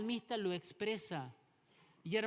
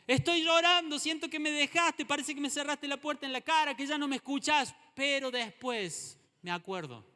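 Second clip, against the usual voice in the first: anguished cry; 8 semitones higher, 7 dB louder than usual. Pretend. Voicing the psalmist's anguish.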